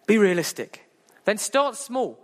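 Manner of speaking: ordinary talk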